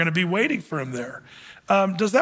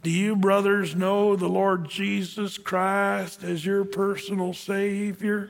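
lightly voiced slow Southern drawl; a hint of a drawl, words drawn out slowly; slower than usual. Peter asking the giant strangers about Jesus, light slow Southern drawl. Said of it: "he talked really slow like this with a southern drawl".

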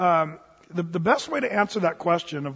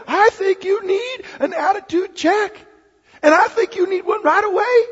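much higher, louder coach demanding an attitude check; high-pitched coach catchphrase; 13 semitones higher, 6 dB louder than usual. Retold or imitated. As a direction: deliver the coach's signature line up high. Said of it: "He'd end it with".

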